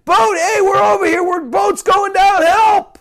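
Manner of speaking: high, urgent shout; yelling for help. Acted. Castaway yelling at a cruise ship, frantic shout. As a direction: holler to a passing ship. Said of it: "waving your arms and yelling".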